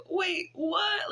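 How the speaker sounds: high-pitched imitation of baffled surprise; taken aback. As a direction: mimic her double take, pitched right up. Acting out woman's confusion at children's ages.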